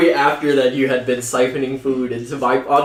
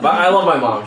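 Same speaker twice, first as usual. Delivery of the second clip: normal talking voice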